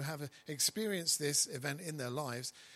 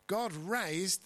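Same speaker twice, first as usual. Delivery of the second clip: raised leaned on to mark the past tense; emphatic past tense raised; stress on raised; 5 semitones higher, 4 dB louder than usual. Shown as a demonstration. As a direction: hit God raised higher and louder. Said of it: "that's got an E D at the end of it".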